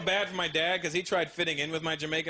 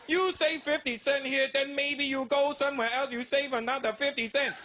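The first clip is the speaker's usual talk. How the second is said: lightly voiced Indian accent, slow and higher; a hint of a thrifty Indian father lecturing; imitating Indian dad; 7 semitones higher than usual. Dad explaining savings to son, light Indian accent.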